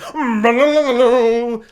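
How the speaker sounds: loud, high trilled trumpet imitation; imitated trumpet bit. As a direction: blare out a rolling trumpet motif by mouth. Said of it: "trumpet bit".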